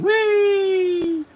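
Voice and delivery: loud, very high held squeal; sustained high-pitched "whee" on one note. Retold or imitated. Imitated noise while watching film.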